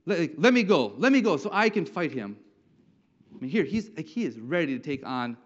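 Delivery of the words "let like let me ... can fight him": louder, higher mock yell; yelling toward Saul. David pleads with Saul to let him fight, mock yelling. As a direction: cry out to be let loose. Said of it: "like, yelling towards Saul".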